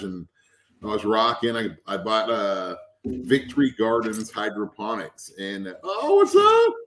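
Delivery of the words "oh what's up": real high, loud hello; very high-pitched, delighted shout-out; excited greeting. Real, not acted. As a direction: squeal a greeting to someone who turns up. Breaks off to greet someone.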